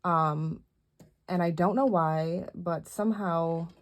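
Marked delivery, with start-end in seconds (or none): none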